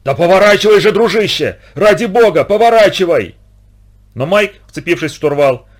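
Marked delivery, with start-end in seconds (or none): 0.0-3.4 high, loud, frantic urging; shouted plea. A narrator's reading. Patch to Mike before the collision, shouting desperately. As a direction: beg at the top of your voice. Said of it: "в это же время закричал Патч".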